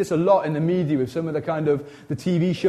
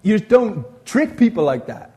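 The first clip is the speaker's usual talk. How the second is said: higher and louder, incredulous; hammed-up outraged listener; mock indignant; 5 semitones higher, 6 dB louder than usual. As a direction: protest loudly in disbelief. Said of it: "'Cause you'd be like".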